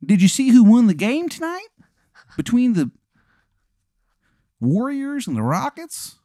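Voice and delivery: high-pitched, hammed-up Maryland voice; bad Maryland accent; horrible Maryland accent. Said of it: "how bad this guy's fucking Maryland accent is".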